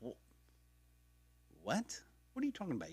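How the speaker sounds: high, stunned what; can't believe what you're seeing; high incredulous what; disbelief. Retold or imitated. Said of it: "I'm like".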